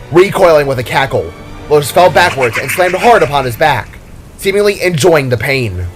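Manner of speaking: ordinary delivery, nothing marked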